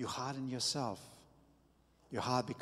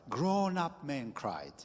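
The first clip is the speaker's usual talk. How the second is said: slow and deliberate; stress on Grown-up; slower than usual. Acted.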